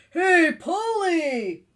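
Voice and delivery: high, loud, drawn-out greeting; lizard calling out. Acted. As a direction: call slowly up to a friend in the tree. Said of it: "said the lizard".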